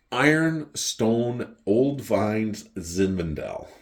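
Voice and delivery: drawn-out, deliberate naming; slow grand announcement. Retold or imitated. Waiter recommends a wine to the customer, slow grand announcement. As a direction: announce each word slowly.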